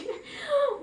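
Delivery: high bright laugh; amused laugh. Real, not acted. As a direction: laugh at your own aside. Laugh after her own remark.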